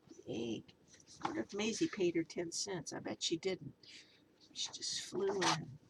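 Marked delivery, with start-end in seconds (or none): 2.9-5.8 conspiratorial whisper aside. Real, not acted. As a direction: let them in on a secret.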